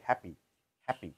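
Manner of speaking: হ্যাপি with a flat unaspirated p, American style; হ্যাপি said hapi, American way; American happy. Shown as a demonstration. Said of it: "আমেরিকানরা বলে". (American উচ্চারণে হ্যাপি)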